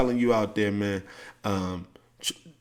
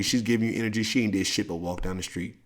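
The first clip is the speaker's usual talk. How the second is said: usual speaking voice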